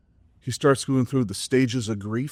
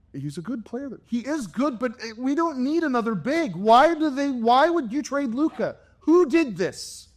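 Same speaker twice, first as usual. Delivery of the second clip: son's rant; 13 semitones higher than usual. Retold or imitated. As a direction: rant it like an outraged kid. Son voiced ranting about trade.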